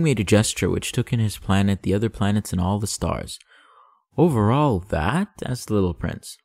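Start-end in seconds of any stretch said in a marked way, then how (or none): 4.2-5.3 slow, wondering rising question; amazed question. Acted. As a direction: marvel at the whole sky. Prince asks in wonder.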